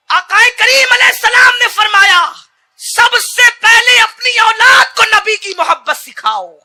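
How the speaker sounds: high, loud fiery sermon; preaching at full force. Acted. Preacher urging parents, roaring high and loud. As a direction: build the crowd with a roar.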